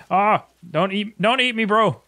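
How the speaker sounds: high, loud panicked pleading; panicked yelling. Acted. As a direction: beg not to be eaten.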